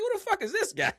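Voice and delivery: very high, loud incredulous question; mock bewilderment. Viewer not recognizing the streamer in his live, incredulous. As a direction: squeal the question in comic disbelief. Acting out disbelief at mismatched streamer.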